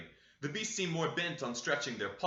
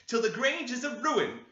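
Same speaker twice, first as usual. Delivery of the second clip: high, raised and cutting curse; sharp, much higher voice; sharp answer; 9 semitones higher, 4 dB louder than usual. A narrator's reading. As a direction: spit the curse at them. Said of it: "she answered sharply".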